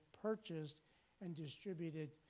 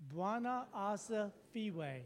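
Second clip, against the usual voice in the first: slow call-and-response; 5 semitones higher, much slower than usual. Shown as a demonstration. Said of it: "Can you say it?".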